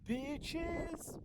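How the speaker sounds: high, loud hype greeting; hype shout. Real, not acted. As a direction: pump up the crowd. Outdoor hype intro. (cri d'entrée surexcité)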